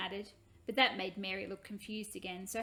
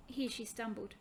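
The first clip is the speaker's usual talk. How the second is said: plain reading voice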